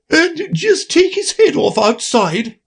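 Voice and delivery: very high shrill queen; high, slow imperious queen. A narrator's reading.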